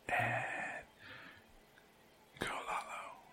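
soft unvoiced whisper cue. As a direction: whisper the handoff. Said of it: "whisper into the microphone".

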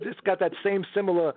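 usual speaking voice

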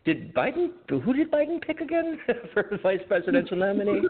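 high, halting mock bewilderment; imitating confused co-host. Disoriented man asking about Biden's pick, high mock-confused voice. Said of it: "identified as James Poling, and asking".

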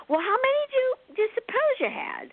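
high sing-song coaxing query; coaxing high question. Retold or imitated. Asking the client how many lives, high and playful. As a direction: float it up high, teasing.

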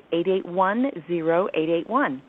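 ordinary delivery

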